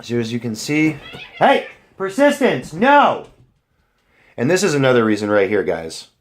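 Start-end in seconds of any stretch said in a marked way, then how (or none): 1.4-3.3 sudden high sharp call at the cat; scolding the cat. Real, not acted. Owner scolding cat mid-video, sharp high call. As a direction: stop the cat, loud and high.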